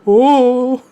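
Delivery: high, loud comic "oh"; loud mock howl from a guy being hurt; pained cry, imitated. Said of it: "he's like".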